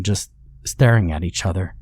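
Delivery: plain reading voice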